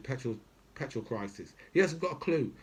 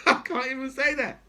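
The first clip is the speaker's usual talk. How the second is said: laughing; 13 semitones higher, 5 dB louder than usual. Real, not acted. Laughing at own outrage.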